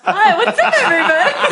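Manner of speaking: high-pitched playful hello; high goofy greeting. Pretend. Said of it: "[name] seduced me with his voice".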